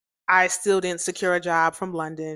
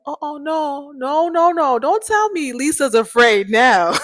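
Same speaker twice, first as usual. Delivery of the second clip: mock alarm; 8 semitones higher, 8 dB louder than usual. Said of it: "I was like".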